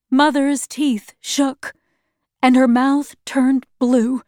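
ordinary delivery

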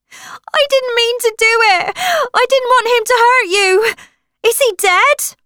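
high, loud panicked screaming. Acted. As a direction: scream it out in terror. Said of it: "Jenna was screaming".

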